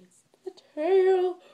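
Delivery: high, loud and slow, stretching "tail"; loud gleeful reveal. Real, not acted. Showing viewers the tail under her arm, loud and gleeful. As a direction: belt it out with glee.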